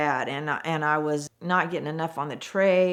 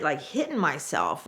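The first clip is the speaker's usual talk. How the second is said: ordinary delivery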